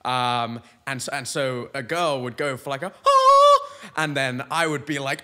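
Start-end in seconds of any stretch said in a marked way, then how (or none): 3.1-3.9 loud, very high sustained "ah"; mock high note. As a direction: belt out a piercing high note in imitation. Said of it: "go for this high note".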